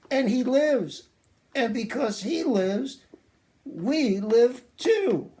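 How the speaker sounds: put-on high, eager puppet boy; chirpy sidekick puppet reciting slowly. Puppet Larry recites the lesson.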